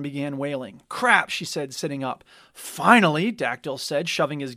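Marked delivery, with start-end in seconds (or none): 0.9-1.3 sharp, loud and higher; alarmed curse. A narrator's reading. Kopesh as the alarm klaxon wails, alarmed. 2.5-3.3 loud, eager exclamation; finally some action; gleeful. A narrator's reading. Dactyl hearing the alarm, gleeful.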